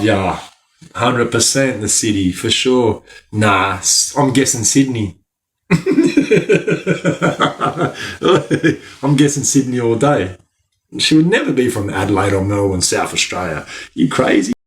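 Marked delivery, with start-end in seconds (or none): 5.7-8.8 long high burst of real laughter; big laugh. Real, not acted. Laughing hard at guessing a viewer's city. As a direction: crack up at your own guess.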